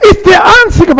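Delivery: preaching shout. Real, not acted. Preacher crying out the point.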